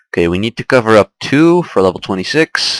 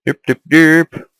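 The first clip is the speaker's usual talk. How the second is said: dopey derp voice; 7 semitones higher than usual. Pretend. Mocking a dopey mob.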